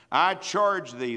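slow, weighty I charge thee; old preacher charging the young one; solemn charge. Acted. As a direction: lean on charge. Paul's charge quoted.

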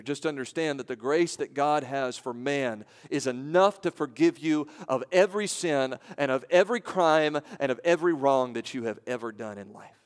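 ordinary talk, nothing marked

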